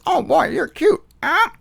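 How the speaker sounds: high parrot mimicry. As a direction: mimic the bird repeating its line. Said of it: "the parrot says".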